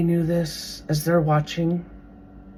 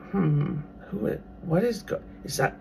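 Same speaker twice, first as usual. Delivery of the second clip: puzzled whisper; 10 dB quieter than usual. Retold or imitated. Philistines puzzled by the boy.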